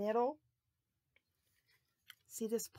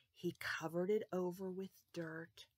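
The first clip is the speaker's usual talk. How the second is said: usual speaking voice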